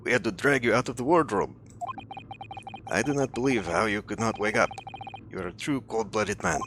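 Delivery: put-on Russian accent, accusing. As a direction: play a Russian captor with a thick accent. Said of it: "possibly a Russian accent".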